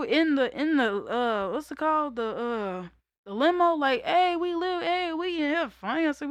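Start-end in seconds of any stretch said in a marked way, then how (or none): 4.0-6.1 high, bouncy hype; excited party cheer. Retold or imitated. Cheering in the funeral limo while everyone mourns, high and bouncy.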